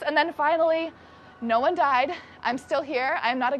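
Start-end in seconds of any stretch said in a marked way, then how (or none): none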